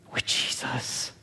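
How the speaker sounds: whispered and slow. Real, not acted. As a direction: drop to a hushed whisper on the name. Hushed climax of a sermon line.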